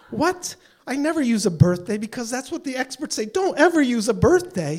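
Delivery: high, emphatic disbelief; incredulous. Retold or imitated. Caller told the PIN is a birthday, incredulous. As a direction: insist it cannot be so.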